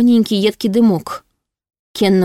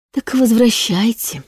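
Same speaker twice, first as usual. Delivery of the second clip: slow, higher, cloying menace; sickly-sweet hiss; 4 semitones higher, slower than usual. A narrator's reading. Demon tells heroine to send him back, sweetly hissing. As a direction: hiss it slowly with fake tenderness. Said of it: "приторно-ласково прошипел".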